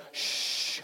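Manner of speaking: firm "shh"; short, pressing hush; insistent shush. Retold or imitated. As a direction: hush the baby through your impatience. Shushing between complaints.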